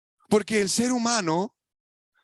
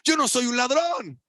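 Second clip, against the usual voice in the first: a touch of an indignant God, raised; preacher voicing God's protest; 6 semitones higher than usual. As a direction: speak for God, firm and offended. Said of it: "porque está diciendo".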